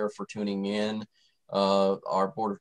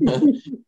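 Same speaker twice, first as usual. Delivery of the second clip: high laugh; 5 dB louder than usual. Real, not acted. Laughs at own joke.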